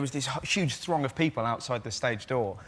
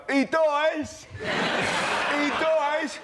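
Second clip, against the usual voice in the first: put-on drunken shout; 13 semitones higher, much slower than usual. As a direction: bellow the spoiler like a drunk stranger. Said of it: "someone who's drunk would just wander past and go".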